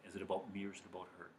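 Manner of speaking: softly and fast under the breath; rapid hushed mantra; quiet fast self-question. Shown as a demonstration. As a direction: murmur it to yourself quickly. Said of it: "this to be your mantra".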